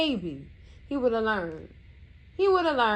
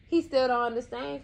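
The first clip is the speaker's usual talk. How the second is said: ordinary talk, nothing marked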